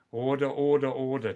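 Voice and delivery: loud, gavel-banging speaker of the house; mock order call. Calling chat to order like parliament.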